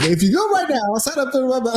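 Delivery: high hammed-up sales pitch; hard sell ad. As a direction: push the deal like a TV ad.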